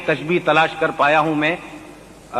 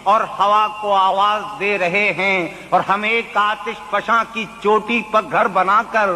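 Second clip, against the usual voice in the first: poetry recitation; 5 semitones higher than usual. Acted. Mushaira recitation.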